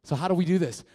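ordinary delivery

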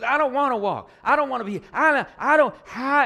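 put-on high, loud whining little girl; whiny toddler. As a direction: whine like a two-year-old refusing to walk. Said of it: "She goes".

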